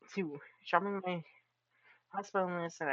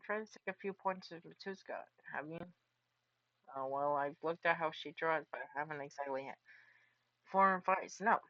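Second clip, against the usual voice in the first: normal talking voice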